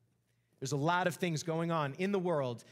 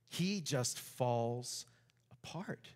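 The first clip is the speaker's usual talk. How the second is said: very slow and soft, fading to a whisper on apart; dramatic slow drop; 5 semitones lower, 5 dB quieter, much slower than usual. Shown as a demonstration. Preacher to congregation, slow and fading to a whisper. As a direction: let the words sink and die away. Preacher stresses Jonah's collapse.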